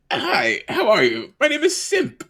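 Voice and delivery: high squeaky kobold greeting. Acted. Simp greeting the party again in a squeaky high voice. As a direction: chirp it like a tiny lizard creature.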